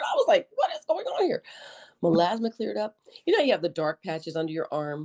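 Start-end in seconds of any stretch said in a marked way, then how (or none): 0.0-1.4 much higher, mock astonished "What is going on here?"; amazed. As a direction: squeal it in playful disbelief. Retold amazement at clear skin.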